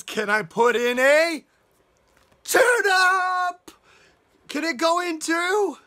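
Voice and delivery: high, loud excited begging; eager pleading duck. Acted. Storyteller voicing Duck begging to add turnip, excited. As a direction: beg loudly, rising to a high cry.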